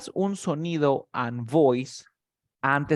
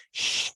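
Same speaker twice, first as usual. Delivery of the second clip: long voiceless "shh" hiss; SH sound; 4 dB quieter than usual. Shown as a demonstration. Teacher showing a student the SH sound, voiceless hush. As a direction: push out air as "shh", no voice. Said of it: "el sonido de la S H, mira".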